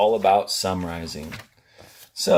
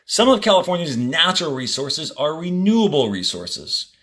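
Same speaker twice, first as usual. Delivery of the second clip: reading aloud to class; 6 semitones higher, 3 dB louder than usual. Acted. Teacher reading the passage to students, clear and measured. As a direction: read each sentence out for students.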